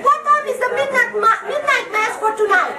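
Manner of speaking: high, earnest caller voice asking in full sincerity; lightly voiced naive parishioner; clueless caller. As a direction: play the oblivious caller. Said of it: "Hello, hello. Hello".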